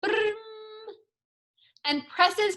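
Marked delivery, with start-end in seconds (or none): 0.0-0.3 higher buzzing brrrm like a tuba; a light pretend tuba buzz; lip buzz. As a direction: buzz your lips as if playing a tuba. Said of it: "She buzzes her lips just like she's playing".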